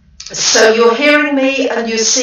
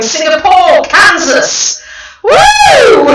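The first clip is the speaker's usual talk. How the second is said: very high, loud woo into a laugh; real delighted cheer; excited whoop; 10 semitones higher, 6 dB louder, slower than usual. Real, not acted. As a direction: whoop with joy then laugh. Excited live greetings, whoop.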